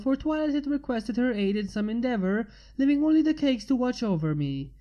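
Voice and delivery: ordinary delivery, nothing marked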